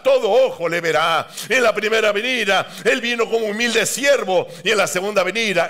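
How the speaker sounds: usual speaking voice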